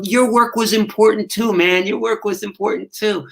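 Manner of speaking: high, earnest little mouse voices; small high voices giving heartfelt thanks; grateful mice. Retold or imitated. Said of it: "and they go".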